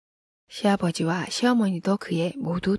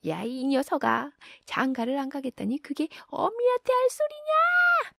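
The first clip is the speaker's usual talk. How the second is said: angry yelling; 9 semitones higher than usual. A narrator's reading. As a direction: holler after your son as you chase him. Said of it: "고래고래 큰 소리를 외쳐댔다".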